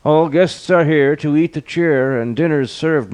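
high, formal butler's call; butler announcing. A narrator's reading. Butler calling the guests to dinner, high announcing voice. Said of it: "The butler bowed".

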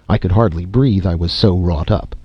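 ordinary delivery, nothing marked

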